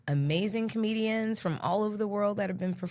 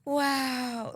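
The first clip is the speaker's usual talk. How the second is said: Jennifer Coolidge wow; 6 semitones higher than usual. Retold or imitated. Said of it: "Jennifer Coolidge is more like".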